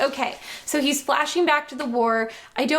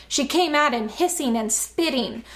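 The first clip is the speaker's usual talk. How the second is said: ordinary talk, nothing marked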